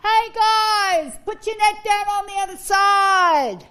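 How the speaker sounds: loud, high shout across the water; high, carrying call from a distant shore. Acted. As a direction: holler to people far off, stretching the words. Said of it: "he's calling out".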